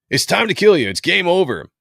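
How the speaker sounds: high, loud comic-book villain threat; dramatic threat. Acted. Comic reader voicing the foe threatening Batman, dramatic shout. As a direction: declaim it hard, pitched well up.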